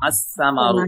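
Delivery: আস-সামারু with the S doubled and the L silent; teacher giving the correct আস-সামারু; right way, doubled s. Shown as a demonstration. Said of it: "এমন না".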